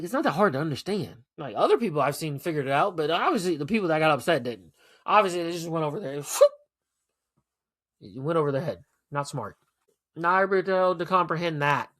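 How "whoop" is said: a sudden very high, loud whoop; high whoop sound effect. Acted. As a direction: fling out a sharp squeal-like whoop. Gesture of it flying overhead.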